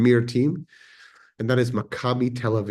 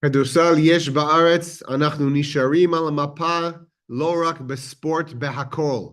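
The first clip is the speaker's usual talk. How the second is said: Hebrew with a lightly put-on American accent, louder; 6 semitones higher, 5 dB louder, much faster than usual. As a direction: mimic an American speaking Hebrew, a bit louder. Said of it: "if I use my best American accent in Hebrew".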